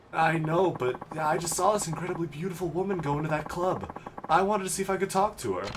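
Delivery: put-on high, earnest kid voice; smitten teenage boy. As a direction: gush like a kid with a crush.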